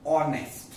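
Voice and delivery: ऑनेस्ट said with silent h, starting on ओ; model with o sound. Shown as a demonstration. Teacher showing students the silent h in ऑनेस्ट. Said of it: "तो ओ का sound आता है".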